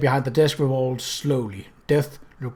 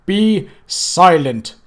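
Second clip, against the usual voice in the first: slow, louder menacing voice of Death; Death, cold and menacing; 4 semitones higher, 6 dB louder, much slower than usual. Acted. Death confronts the intruders, slow and menacing. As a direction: loom over them with every word.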